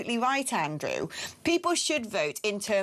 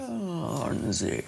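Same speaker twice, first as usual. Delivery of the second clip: "Barnesy" stretched long and slow; drawn-out nickname; 4 semitones lower, much slower than usual. Real, not acted. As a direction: draw out both syllables of "Barnesy". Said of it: "everyone says it like this now".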